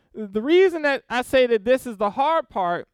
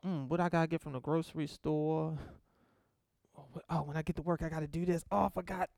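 softer, lower, hurried muttering to oneself; distracted inner thoughts; 5 semitones lower, 12 dB quieter, faster than usual. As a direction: play a worshipper whose mind drifts to chores. Acting out a wandering mind.

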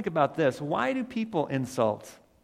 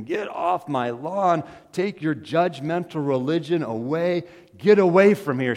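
angry yelling; 4 semitones higher, 9 dB louder than usual. Retold or imitated. Angry neighbor ordering Tracy off her lawn, retold loud shout. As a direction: yell it at the neighbor, getting louder to the end. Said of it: "She was angry. She started cursing".

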